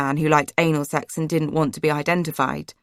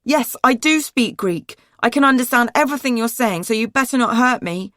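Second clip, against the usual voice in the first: defiant shout; 6 semitones higher, 3 dB louder than usual. A narrator's reading. Girl warning her captors she understands, shouting. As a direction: shout it out as a threat. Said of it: "I started shouting".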